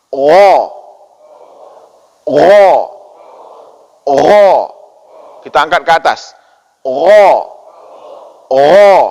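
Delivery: gha voiced loud and rough from the back of the throat; throaty gha drill. Shown as a demonstration. Teacher leading the class, each gha rolled loud from the throat. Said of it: "Kita baca sama-sama".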